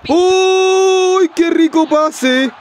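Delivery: high, slow, delighted exclamation; excited. Real, not acted. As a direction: savor the pass out loud. Admiring his own pass.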